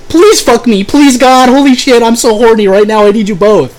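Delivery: high, loud, hammed-up pleading; desperate mock begging. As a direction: beg on your knees in a wild overacted voice. Mocking the horny magician.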